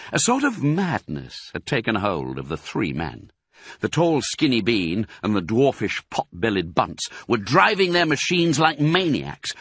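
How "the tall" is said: usual speaking voice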